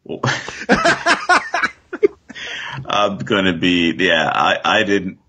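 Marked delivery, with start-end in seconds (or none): 0.2-1.7 high, breathy burst of laughter. Real, not acted. Laughing at co-host's remark.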